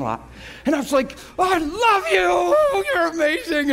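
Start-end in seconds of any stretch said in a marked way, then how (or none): 1.4-3.7 high, loud cry of adoration; ecstatic shouting. Retold or imitated. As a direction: cry out praise, overcome with joy. Re-enacts shouting praise to Jesus.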